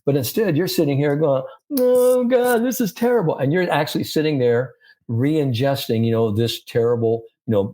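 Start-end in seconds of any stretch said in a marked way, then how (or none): 1.8-3.4 high-pitched, exaggerated moan of self-pity; mock despair. Coach mimics a golfer wallowing over a bad shot, mock despair. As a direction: wail it like a hammed-up sulking golfer.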